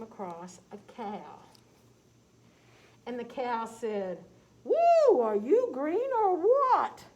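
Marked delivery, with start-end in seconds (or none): 4.6-7.0 very high, loud, slow hoot; cow character voice. Acted. Cow meeting green Tom, loud high slow hoot.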